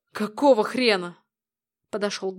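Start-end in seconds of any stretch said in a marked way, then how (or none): 0.1-1.2 sharp angry question; angry outburst. Acted. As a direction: snap it out in anger. Angry outburst on seeing keys.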